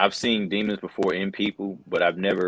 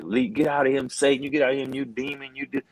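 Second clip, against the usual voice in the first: higher, pleading deliverance prayer; wife's fervent prayer; 6 semitones higher than usual. Retold or imitated. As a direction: imitate the wife commanding the demon out. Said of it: "and she's like".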